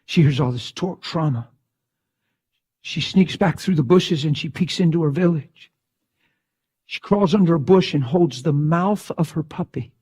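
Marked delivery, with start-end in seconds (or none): none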